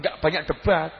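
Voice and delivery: normal talking voice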